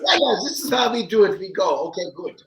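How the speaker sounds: put-on high, fast German-accented orders; German director impression. Director brushing off the actor, fast German accent. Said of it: "he's like".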